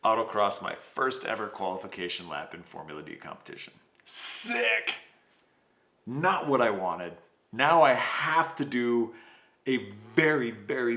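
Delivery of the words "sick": high mock cheer, dripping sarcasm; sarcastic sick. Driver mocking his own blown lap, sarcastic high cheer. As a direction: fake a bright cheer for a disaster.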